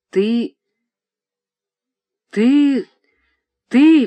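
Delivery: лепет, нарастающий выше и громче; твердить одно слово, всё громче и выше; растерянный лепет. A narrator's reading. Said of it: "бессмысленно залепетал старик". (старик в потрясении лепечет дочери: нарастающий лепет)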